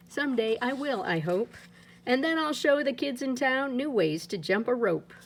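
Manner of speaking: usual speaking voice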